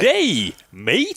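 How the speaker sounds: high, hammed-up Australian greeting; Australian accent. Co-host answers the American impression, hammed-up Australian accent. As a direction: lay on a broad Australian accent. Counters with mock Aussie greeting.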